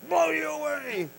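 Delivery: high, loud announcer intro, stretched; hype man. Pretend. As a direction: boom it out like an arena hype man. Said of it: "just the warm-up guy".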